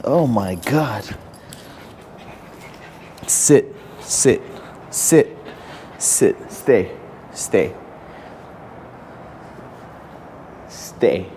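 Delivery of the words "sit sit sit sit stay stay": lower, firm command voice; dog-trainer voice, low and even. Real, not acted. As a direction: set the dog down with steady orders.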